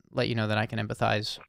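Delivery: usual speaking voice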